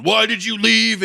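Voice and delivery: high, loud, drawn-out demand; mock yelling. Wrestler confronting his manager backstage, mock yelling. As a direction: yell it as an outraged wrestler. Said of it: "Macho Man's yelling at Elizabeth".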